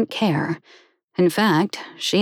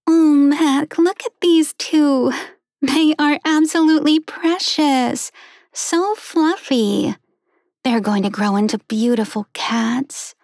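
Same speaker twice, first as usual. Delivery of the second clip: gushing woman; 8 semitones higher than usual. Acted. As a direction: melt over the fluffy little things. Woman gushing over kittens.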